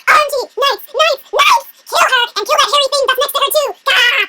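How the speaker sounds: high, loud hammed-up shouting; comic villain yelling for his knights; frantic battle cry. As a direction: shout orders to attack. Stalker summoning knights to kill.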